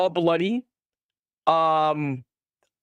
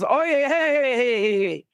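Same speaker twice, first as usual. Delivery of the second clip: much higher, bright hey hey hey; eager greeting; 8 semitones higher than usual. Acted. Raider greets the arriving gang eagerly. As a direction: wave them over eagerly. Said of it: "you see the guy goes".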